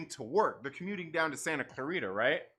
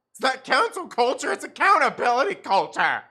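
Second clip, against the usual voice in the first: loud declaration; 5 semitones higher, 10 dB louder than usual. Real, not acted. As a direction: punch it out loud, a touch higher.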